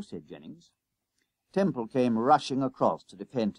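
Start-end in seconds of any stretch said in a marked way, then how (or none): none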